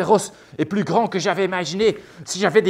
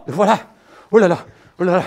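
ordinary talk

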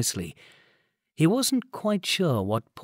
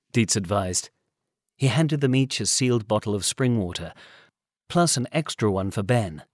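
ordinary delivery

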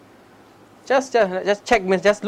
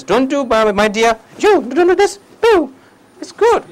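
gentle mother; 8 semitones higher, 4 dB louder than usual. Retold or imitated. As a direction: coax the baby kindly, like a tender mother. Mother gently correcting her baby.

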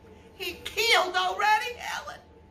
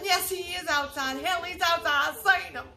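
ordinary talk

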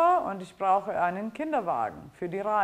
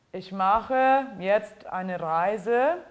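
slow teaching voice; 3 dB louder, slower than usual. Shown as a demonstration. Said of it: "teacher models a German sentence".

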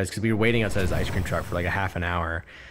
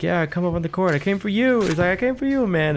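high, louder cheerful vendor voice; imitating the ice cream man; 10 semitones higher, 5 dB louder than usual. Vlogger imitates the ice cream man calling him up to the court, high and cheery. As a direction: call out like a friendly man welcoming a customer. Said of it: "he was like".

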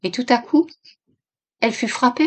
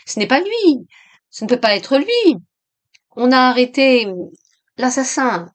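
higher, louder desperate denial; strained effort; 5 semitones higher, 5 dB louder than usual. A narrator's reading. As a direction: insist against your own rising dread. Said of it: "effort terrible pour maintenir les pensées". (dénégation tendue et angoissée)

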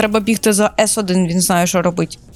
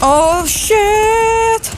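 very high, drawn-out panic cry; alarmed squeal; 12 semitones higher than usual. Real, not acted. Sudden danger in game. (переляканий високий вигук)

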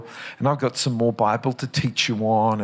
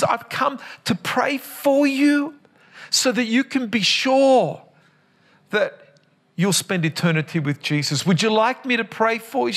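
much higher, slowed, carrying to the sick; raised voice; 9 semitones higher than usual. Retold or imitated. Speaking to deaf aunt.

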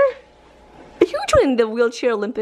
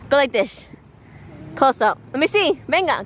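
ordinary talk

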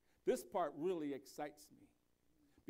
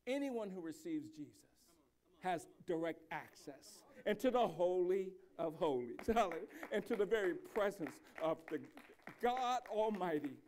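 full-force preaching; 5 dB louder than usual. Acted. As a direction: proclaim it to the whole church.

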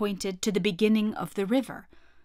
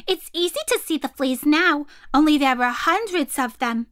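high, louder monkey voices; little monkeys; 5 semitones higher, 4 dB louder than usual. Acted. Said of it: "said the six little monkeys".

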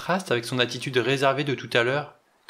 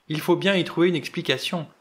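higher, louder, frightened pleading; frightened stammer; 5 semitones higher, 3 dB louder than usual. Acted. Butler begging the doctor to believe him, frightened. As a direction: blurt it out, scared he sounds mad. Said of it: "Il déglutit avec peine et bredouilla".

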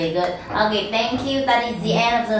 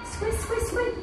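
tiny high squeaks; mouse squeak; 14 semitones higher, 8 dB quieter than usual. Acted. Teacher voices mouse sound.

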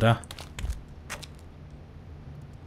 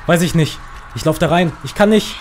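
panicked; 6 semitones higher than usual. Real, not acted. Panicked escape from monster.

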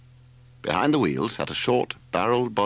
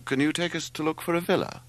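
lightly voiced young man, pitched higher; Larry; 9 semitones higher than usual. A narrator's reading. Larry asking the driver for help, lightly voiced. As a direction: ask it in a slightly put-on higher voice.

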